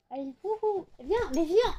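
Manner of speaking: high, soft swooping encouragement; coaxing pet call. Real, not acted. Owner coaxing pet to come, high soft call. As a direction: coo the pet over.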